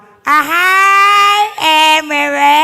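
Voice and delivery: high, long-drawn singsong hello; loud, hammed-up teacherly call; acted teacher greeting. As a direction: greet a pupil in a put-on teacher voice. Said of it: "ครูเขาบอกว่า".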